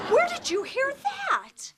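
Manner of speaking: sharply high, stunned question; shocked. Acted. Mother stung by her daughter's jab, shocked high. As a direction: caught off guard, demand it.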